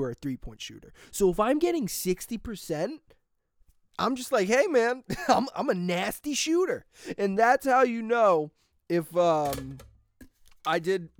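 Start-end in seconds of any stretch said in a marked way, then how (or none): 4.5-6.9 mock brag. Bragging to himself about grades.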